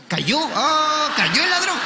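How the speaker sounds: high, scandalised gossip tone; mock shocked parent. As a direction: play the alarmed family gasping about the neighbour. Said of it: "En mi casa decían".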